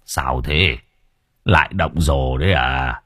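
put-on weary old man brushing off the young thug; gruff old man. A narrator's reading. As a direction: wave him off like a tired old villager. Old man voiced in story.

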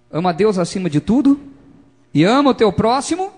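loud, high commandment, each phrase lifted at the end; preacher proclaiming at full voice. Real, not acted. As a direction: call it out to the congregation.

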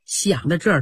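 ordinary delivery, nothing marked

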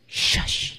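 whispered "shush"; soft, voiceless hush to silence someone; shushing sound. Shown as a demonstration. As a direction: hiss out a quiet shush. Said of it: "叫做".

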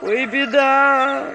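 high, loud, slow wail; loud keening through a door; howling lament. A narrator's reading. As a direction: howl out the trouble like a peasant woman. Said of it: "ревели у меня под дверью".

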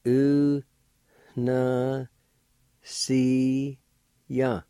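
very slow, long held syllables; teacher sounding a word out slowly. Shown as a demonstration. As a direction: stretch each syllable out with long pauses between. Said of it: "like this".

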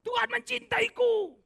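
high, slow, sing-song mocking voice; mock claim. Preacher mocking a smug believer's claim, high sing-song. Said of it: "itu kan klaimku".

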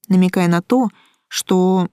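plain reading voice

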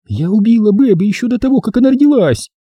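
high-pitched, hysterical female confession; anguished lady, pitched far up; distraught woman. Acted.

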